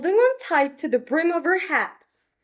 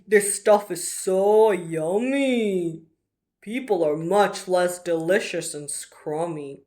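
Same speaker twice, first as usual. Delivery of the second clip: delighted dragon; 7 semitones lower than usual. Acted. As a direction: savour every word.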